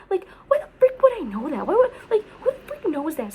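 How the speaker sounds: high, loud whining rant; exasperated whine. Real, not acted. Exasperated near-cry rant.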